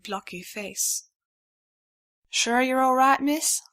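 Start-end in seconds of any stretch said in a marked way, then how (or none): 2.3-3.6 loud, high American Southwest twang; a folksy Southwest drawl, kindly asking; a worried stallion in a Southwest twang checks on the stranger; Southwestern twang. A narrator's reading. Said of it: "in an accent with more than a little twang".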